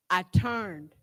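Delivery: ordinary talk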